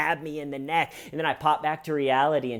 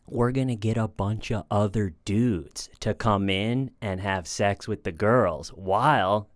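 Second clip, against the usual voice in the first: a low, laid-back hustler pitch, lightly done; 3 semitones lower than usual. Retold or imitated. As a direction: lower voice, pitching an idea to a friend. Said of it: "[name] pitching that idea to a friend".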